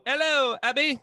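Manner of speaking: high, playful put-on British accent. Shown as a demonstration. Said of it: "go off in the British accent".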